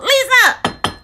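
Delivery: high loud call. Real, not acted. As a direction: call out her name, pleading. Calling for a code.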